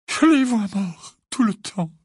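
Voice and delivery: high, tearful moan. A narrator's reading. Missus Wesley confides her fears, moaning. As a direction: moan it out through tears. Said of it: "gémit Missus Wesley".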